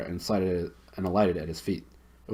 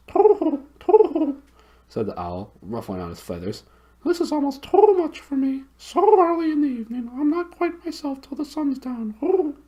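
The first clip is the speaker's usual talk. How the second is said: very high hooting owl voice, far from the narrator's own; high, hooting bird voice; 6 dB louder than usual. Acted. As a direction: hoot it like a big owl. Owl hooting, a little laugh.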